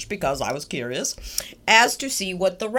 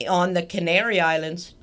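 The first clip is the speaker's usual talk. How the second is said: usual speaking voice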